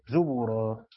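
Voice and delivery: زبرا said "zubura", u after the ب; damma on the ب. Shown as a demonstration. Teacher contrasts this verse with the other, damma on the ب in زبرا. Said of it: "هنا مضمومة".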